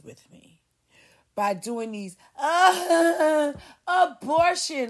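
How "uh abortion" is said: high, slow, hammed-up whine; mock whining. Mocking women whining about abortion, hammed-up cry. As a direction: whine it like a fake sob. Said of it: "they always wanna whine".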